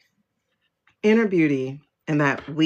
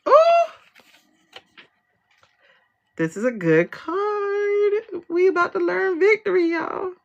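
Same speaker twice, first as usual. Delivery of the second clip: thrilled; 13 semitones higher than usual. Real, not acted. Reader announcing victory to viewers, thrilled. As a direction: squeal it with real delight, stretching the words. Delighted reaction to the revealed card.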